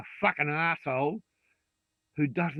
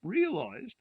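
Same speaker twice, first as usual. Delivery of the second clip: high, slow, whiny mockery of a moaner; mock whining; 14 semitones higher, slower than usual. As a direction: sneer it out like a spoiled complainer. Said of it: "Don't come out of it saying".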